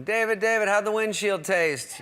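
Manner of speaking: high, loud reporter calling out; hounding paparazzi. Retold or imitated. TMZ guys calling to crash victim, pestering shout. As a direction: shout for the celebrity's attention, slowing into the question. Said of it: "T M Z guys going".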